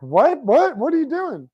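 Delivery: very high, mock-panicked questions; exaggerated high-pitched panic; imitated freak-out. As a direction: squeal it like a flustered crowd. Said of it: "everyone else is freaking out".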